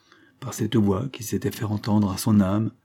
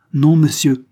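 loud, higher-pitched vehement refusal; 6 semitones higher, 10 dB louder than usual. A narrator's reading. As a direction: cut in forcefully with a raised voice. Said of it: "ces paroles véhémentes".

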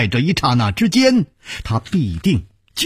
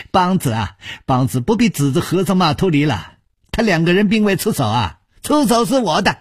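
high, fast, wheedling villain voice; cackling old villain; 7 semitones higher, faster than usual. Acted. Bai Yumo owns up to his chief, high quick villain. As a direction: rattle it off high and sly. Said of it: "白玉魔咯咯的笑着说".

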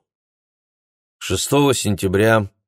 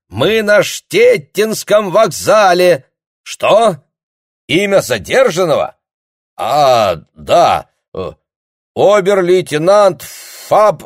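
loud, high shouting into the receiver; shouting on the phone; 11 semitones higher, 8 dB louder, slower than usual. Acted. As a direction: bellow it down a bad line. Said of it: "обрадованно заорал капитан".

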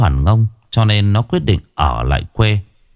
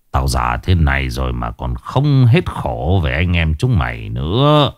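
put-on weary old father; 7 semitones lower than usual. A narrator's reading. Old father sighs about sons.

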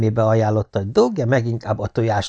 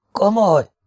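astonished; 10 semitones higher, 3 dB louder than usual. A narrator's reading. Nathan amazed.

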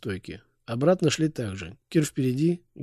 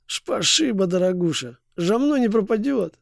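slushy lisp; 6 semitones higher than usual. Acted. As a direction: lisp it like a toothless drunk. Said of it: "шепеляво произнёс".

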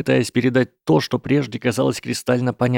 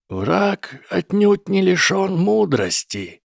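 put-on high, slow old wizard; Gandalf voice; 10 semitones higher, slower than usual. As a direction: speak slowly like a wise wizard. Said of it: "сказал Гэндальф".

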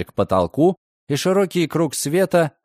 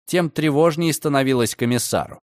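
usual speaking voice

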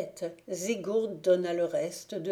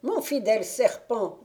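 witch to serpent; 4 semitones higher, 5 dB louder than usual. A narrator's reading. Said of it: "dit-elle".

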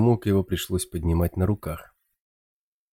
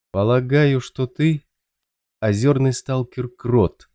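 slow, careful, higher-pitched guess; cautious; 5 semitones higher, 3 dB louder, slower than usual. A narrator's reading. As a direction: feel your way through the words. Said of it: "осторожно сказал Хук". (осторожно, медленно)

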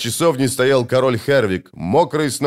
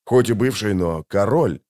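mildly hesitant afterthought; a touch of doubt; unsure; 2 semitones lower than usual. A narrator's reading. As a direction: concede it without conviction. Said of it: "неуверенно сказал Геральт".

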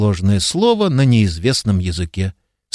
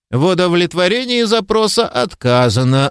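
curt refusal; 7 semitones higher than usual. A narrator's reading. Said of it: "отрезала книга".

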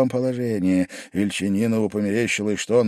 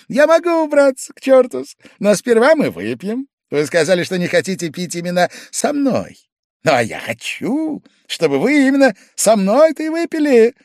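Pavel Pavlovich, defiant; 12 semitones higher, 7 dB louder than usual. A narrator's reading. Drunk guest leaping up to object, loud and defiant. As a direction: jump in with a high-pitched refusal.

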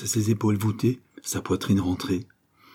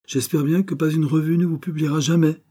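higher, vehement declaration; vehement wish; 8 semitones higher, 3 dB louder than usual. Acted. Poet to the unpublished writer, vehement. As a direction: insist on it right to the last word.

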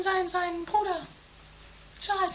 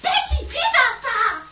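loud call through the house; mother calling Betty, loud; 3 semitones higher, 6 dB louder than usual. Acted. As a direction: shout the news to Betty.

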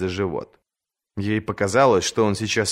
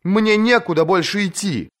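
high, urgent plea; pleading; 11 semitones higher than usual. A narrator's reading. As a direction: beg with nowhere to go. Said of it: "взмолилась она".

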